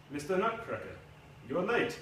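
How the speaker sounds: lightly put-on French accent, teasing; a hint of thick French accent, a villain savouring the moment. Spaniard scolds the late Nutcracker, mock French accent. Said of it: "he said in a thick French accent".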